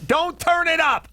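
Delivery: sudden high yell; heated family shouting. Retold or imitated. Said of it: "I'm like".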